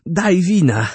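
an inventor calling for wine to celebrate; eager old inventor. A narrator's reading. Narrator voices Orbano.

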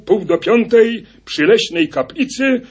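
high, loud announcement of the muster; rally the hunters like a leader; solemn proclamation. A narrator's reading. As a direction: huntsman summoning the hunters. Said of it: "rzekł".

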